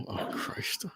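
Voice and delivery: breathy under-the-breath oh Christ; whispered aside. Real, not acted. Friend groaning at the Mario brothers in a whisper. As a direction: say it to yourself, barely voiced.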